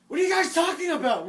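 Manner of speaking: high, loud, nosy yelling; mock loud classmate. Classmate calling out the cheater, loud and high. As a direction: ham up the tattling kid. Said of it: "I'd be talking with Adrian".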